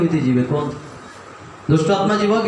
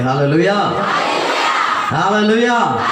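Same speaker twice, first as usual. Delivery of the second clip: shouted hallelujah. Shown as a demonstration. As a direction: belt out hallelujah for the church. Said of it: "जोर से चिल्ला के बोले".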